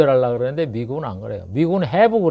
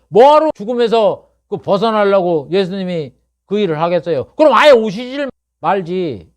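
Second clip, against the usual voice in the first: higher, louder pulpit voice; fiery preaching; 7 semitones higher, 5 dB louder than usual. Acted. Preacher pleading with his congregation, higher and louder. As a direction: drive the point home to the congregation.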